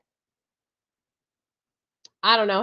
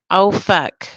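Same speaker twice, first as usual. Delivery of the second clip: louder mock "Oh, fuck" of dismay; mock dismayed curse; 8 dB louder than usual. Warning viewers, voicing their future self, loud mock curse. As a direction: play the future self cursing. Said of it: "You're gonna be saying".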